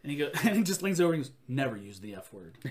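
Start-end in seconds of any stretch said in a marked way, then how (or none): 1.5-2.6 much lower, softer giant warning with a laugh; low stern giant, cracking up; mock-stern lowered voice, laughing; Andre voice. Andre scolding through laughter.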